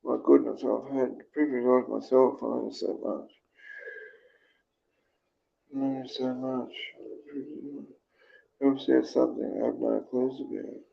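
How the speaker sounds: quiet, subdued read-out; reading comments aloud. Real, not acted. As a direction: murmur through the comments on screen. Reads viewers' comments.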